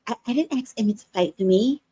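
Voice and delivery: soft, breathy mocking [name] impression; mock a meek soft whine like [name]. Mocking Damien's excuse to viewers, soft [name]-like voice. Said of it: "this [name] voice".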